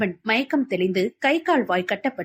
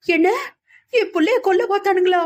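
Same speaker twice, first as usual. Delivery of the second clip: high, louder breaking cry of a mother; a mother's voice cracking into tears, much higher; starting to cry; 9 semitones higher, 4 dB louder than usual. A narrator's reading. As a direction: wail it in shock as tears come. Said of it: "என அழத் தொடங்கினார்".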